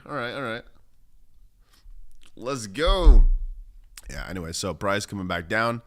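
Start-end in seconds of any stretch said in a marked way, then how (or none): 2.3-3.2 loud, punchy let's go; excited stream shout; hype shout. Real, not acted. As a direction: pump the chat up.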